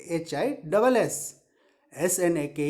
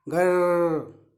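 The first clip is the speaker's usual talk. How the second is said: long rolled-r grrr; teacher's demonstration growl; tiger growl; 3 semitones lower, 3 dB louder, much slower than usual. Shown as a demonstration. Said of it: "ऐसे जी आर आर आर".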